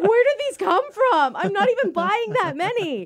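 loud, high-pitched bewildered outburst; exasperated disbelief. Retold or imitated. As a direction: exclaim at the top of your voice, baffled. Acting out bewilderment at bags found.

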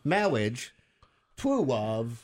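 put-on baby talk, r and l turned to w in "Marriage" and "Twuwov"; Mad Dog impression. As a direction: say "Marriage" as "mawwiage" and "Twuwov" with babyish w sounds. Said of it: "he had, like, the baby talk".

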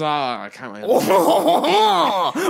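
loud, high mock-French exclamation; hammed-up slow French accent. Traveller imitates a French ticket seller, mock French accent. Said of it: "in his French accent".

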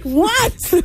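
high, exasperated "What?". Retold or imitated. Mother answering her nagging kids, exasperated. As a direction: snap back fed up at the kids. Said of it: "I'm like".

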